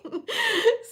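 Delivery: squeaky crying laugh; high wailing laugh; high laugh-sob. Real, not acted. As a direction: break into a tearful giggle. Laughing about her crying.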